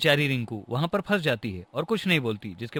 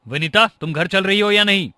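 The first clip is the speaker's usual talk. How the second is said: loud, raised-pitch ultimatum; angry demand; 7 semitones higher, 9 dB louder than usual. Acted. Husband orders his wife to come home, angry. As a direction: call her out sharply, loudly.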